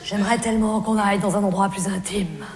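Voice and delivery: low, deep mock male voice; Jean-Loup's voice. Jean-Loup wrongly thinks she likes it, in a low mock-male voice. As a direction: purr it like a smug suitor.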